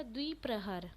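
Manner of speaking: ordinary talk, nothing marked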